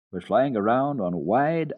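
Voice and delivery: ordinary delivery, nothing marked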